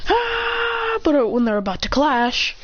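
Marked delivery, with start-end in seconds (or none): 0.1-1.0 high crying ah; high anime effort cry; high strained yell. Acted.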